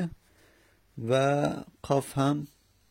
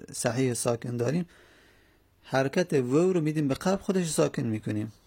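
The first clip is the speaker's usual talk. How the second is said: ordinary talk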